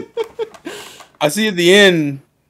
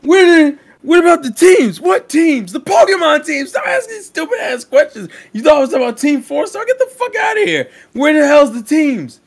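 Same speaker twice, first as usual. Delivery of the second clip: panicked office yelling; 9 semitones higher than usual. Retold or imitated. Writers in the office frantically shout about the teams. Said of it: "they're like".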